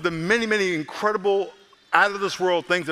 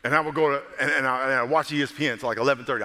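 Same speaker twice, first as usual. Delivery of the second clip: ordinary delivery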